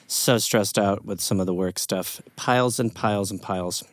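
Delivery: stressed boss. Acted. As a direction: sigh out the stress of work.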